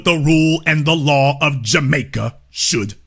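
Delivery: low, measured decree; solemn pronouncement. Real, not acted. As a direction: lay it down like a ruling, deep and soft. Solemn verdict after the host act.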